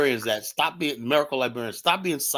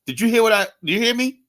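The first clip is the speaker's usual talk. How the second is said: higher and louder, calling across the room; 9 semitones higher, 4 dB louder than usual. Retold or imitated. Customer calling the ignoring waitress, raised voice. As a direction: call out to get the waitress's attention.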